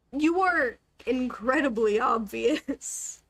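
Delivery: slow, stretched-out delivery; drawn-out teasing. Real, not acted. Streamer teasing a conspicuous character, slow drawn-out emphasis. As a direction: linger on each word to rub it in.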